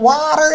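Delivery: exaggerated "water" with the final r sounded; Emily Blunt. British actress mimicking her kids' word, exaggerated "water" with final r. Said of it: "the way she says it".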